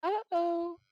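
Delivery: high squeaky voice. Acted. Keeps the squeaky character voice.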